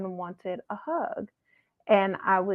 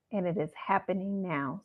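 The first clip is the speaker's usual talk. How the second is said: ordinary talk, nothing marked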